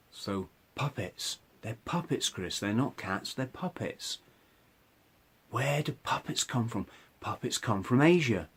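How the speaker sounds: psychotic inner voice. Retold or imitated. Retelling the voice about the cats, soft eerie murmur. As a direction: speak it like a voice inside your head. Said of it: "the voice starts saying".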